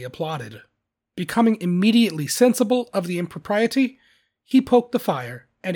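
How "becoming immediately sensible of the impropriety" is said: ordinary delivery, nothing marked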